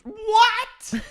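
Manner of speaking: shrieked very high and loud; a mock scandalized shriek; shocked what. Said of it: "And Charlotte goes".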